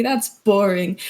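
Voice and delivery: ordinary talk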